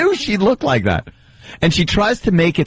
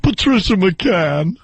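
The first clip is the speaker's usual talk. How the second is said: Patricia McCann impression; slower than usual. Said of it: "She's got that voice".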